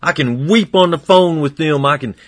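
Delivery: full-voiced revival preaching; fervent preaching. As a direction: preach it with building fervor. Preaching builds.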